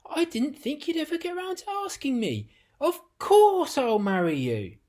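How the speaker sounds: high, loud, happily surprised; delighted cry. Acted. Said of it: "she cried".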